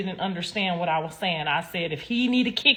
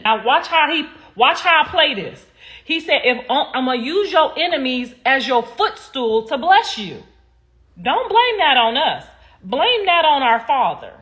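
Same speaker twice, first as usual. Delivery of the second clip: preaching; 5 semitones higher, 5 dB louder than usual. Acted. Preaching about God's children.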